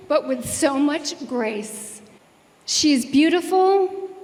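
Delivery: ordinary talk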